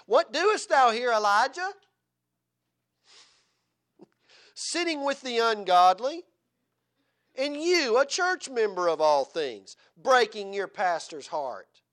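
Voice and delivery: loud, slow, high rebuke like a prophet; prophet's rebuke. Retold or imitated. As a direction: point the finger and thunder at him. Boy rebukes drunk like prophet.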